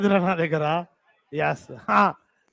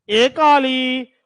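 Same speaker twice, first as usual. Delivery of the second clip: slow, louder teasing echo of ఏ Kali; mock drawn-out question; 4 semitones higher, 5 dB louder, much slower than usual. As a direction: drag out the question as if mocking it. Repeating a student's question back. (ఏ కాళి అని సాగదీసి వెక్కిరింపుగా అడగడం)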